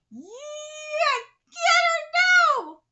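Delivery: high, loud call to attack; cheering shout. Acted. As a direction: bellow it from ringside. Cheering line from the manga.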